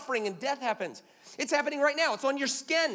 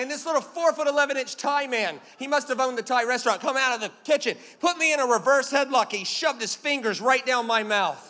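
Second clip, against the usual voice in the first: ordinary talk, nothing marked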